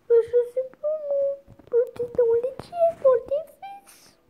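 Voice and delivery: very high, loud squeaky whine, slowing at the end; hammed-up whiny voice. Arguing with dad where to put the chicken, squeaky whine. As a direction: squeal it like a tantrum.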